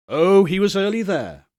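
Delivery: ordinary delivery, nothing marked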